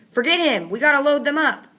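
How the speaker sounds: loud, high urgent shout; shouted order. A narrator's reading. Fatigue urging partner to load kids, loud shout. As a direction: hurry him along, shouting.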